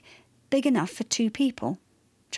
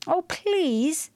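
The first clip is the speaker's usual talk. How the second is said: high, pleading whine; imploring; begging; 6 semitones higher than usual. A narrator's reading. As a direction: beg with a raised, drawn voice. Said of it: "she begged".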